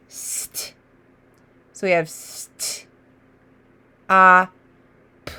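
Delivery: "St" whispered, "ah" voiced, "p" apart; separate sounds. Shown as a demonstration. Teacher sounding out letters for children. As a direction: sound out st, ah, p one by one. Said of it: "I see the blend".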